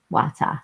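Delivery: Wata said WAH-tah, both vowels open; Patois Wata. Shown as a demonstration. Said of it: "how they say it in Patois".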